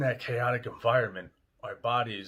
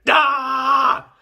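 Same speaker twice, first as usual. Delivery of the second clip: loud, high, sustained "aah" scream; demonstrated scream; 15 dB louder than usual. Shown as a demonstration. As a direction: scream it all out on one held note. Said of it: "Just scream, like".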